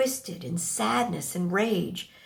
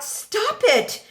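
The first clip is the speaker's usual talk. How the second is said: much higher and louder alarmed call of his name; mother yelling across the yard to stop him; shout; 5 dB louder than usual. Acted. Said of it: "I shout".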